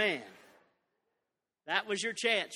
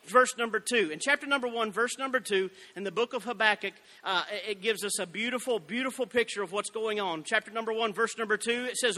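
normal talking voice

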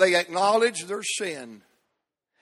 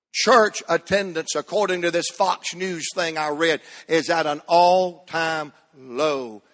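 usual speaking voice

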